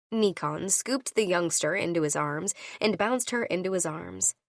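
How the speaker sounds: ordinary delivery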